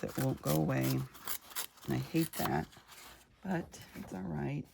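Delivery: ordinary talk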